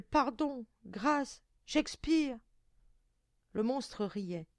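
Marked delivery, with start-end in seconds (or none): 0.0-2.4 high, loud begging; desperate cry. A narrator's reading. Girl dying under the monster, high pleading cries. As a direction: beg as if nearly smothered. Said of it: "s'écria-t-elle".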